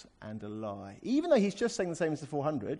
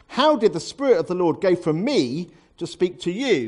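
loud, raised-pitch demand; indignant challenge; 5 semitones higher, 12 dB louder than usual. Acted. False prophet challenging the man he struck, indignant. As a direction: throw it out as an affronted accusation. Acted angry challenge after a blow.

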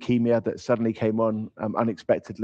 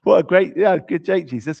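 sarcastic jeer, higher and louder; mocking scoff; 6 semitones higher, 6 dB louder than usual. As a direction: scoff at him like a mourner who thinks it's a bad joke. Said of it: "not a laugh of amusement".

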